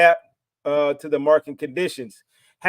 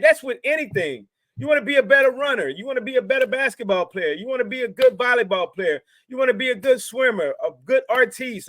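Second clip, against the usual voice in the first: higher, insistent motivational preaching; preaching rant; 5 semitones higher than usual. Real, not acted. To new traders, preaching that effort matters. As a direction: preach the need for hard work.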